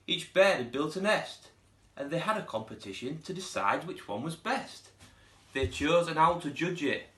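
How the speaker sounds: lively read-aloud storytelling voice; storybook narration. A narrator's reading. Teacher reading a picture book to pupils, lively storytelling. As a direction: read it like story time for little ones.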